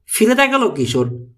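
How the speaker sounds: plain reading voice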